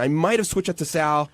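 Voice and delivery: usual speaking voice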